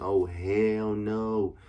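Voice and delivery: very drawn-out "hell no"; long, slow mock refusal; emphatic refusal. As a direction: retell it as a slow, stretched refusal. Repeats refusal to friend, retold.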